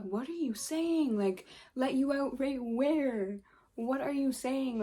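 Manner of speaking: high, drawn-out disbelief; incredulous. Retold or imitated. Retold argument over getting out.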